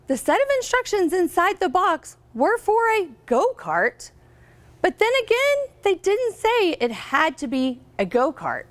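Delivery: storybook reading voice, lively and clear; picture book read-aloud; reading to children. Acted. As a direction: read it out to a class of third graders.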